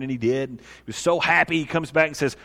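usual speaking voice

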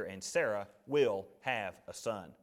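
slow, low, quietly emphatic promise; deliberate and certain, softly weighting each word; God. Acted. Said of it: "That's pretty emphatic right there from God".

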